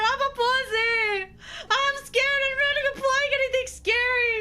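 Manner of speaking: high, panicky squeals of goosebumps; real fright. Real, not acted. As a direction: let the fear spill out in high yelps. Spooked by the story, squealing.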